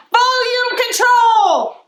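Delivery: booming teacher voice on volume control; shouted, slow and high; loud. Acted. Librarian reading a teacher's scolding, booming loud.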